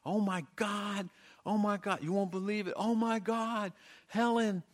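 put-on high, loud, frantic girl's cries; excited screaming. Girl bursting into the house, screaming for Helen. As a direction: burst in yelling for her sister. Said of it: "and screaming".

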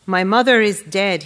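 loud, pained outburst; loud cry. A narrator's reading. Husband at the wedding, grieving his mother, loud. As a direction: nearly shout it out in grief. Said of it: "he wanted to shout".

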